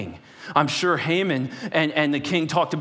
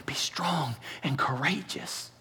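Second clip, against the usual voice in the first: slow hushed whisper of God's word; 6 dB quieter, slower than usual. Acted. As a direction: breathe the command softly. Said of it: "God's words whispered".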